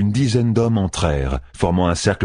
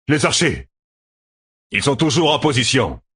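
higher-pitched, pressing reply; urgent announcement; 8 semitones higher than usual. A narrator's reading. Commander tells the officers about the archers, urgently.

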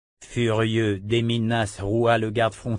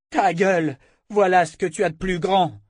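higher, louder scornful yell; 8 semitones higher, 3 dB louder than usual. A narrator's reading. Callan screams an insult at Nas. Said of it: "hurla Callan".

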